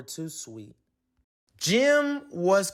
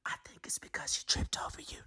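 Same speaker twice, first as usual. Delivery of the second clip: fast, soft secret whisper; a bird whispering to the snake; 10 dB quieter, faster than usual. A narrator's reading. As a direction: whisper it behind his back. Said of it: "Norman whispered to Snake".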